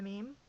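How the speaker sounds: "meme" as one syllable with a long ee; pronunciation option. Shown as a demonstration. Vlogger trying out ways to say it, "meme" with long ee. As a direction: say "meme" to rhyme with team. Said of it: "How do you pronounce it?".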